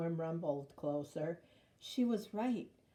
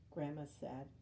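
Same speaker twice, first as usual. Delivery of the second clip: usual speaking voice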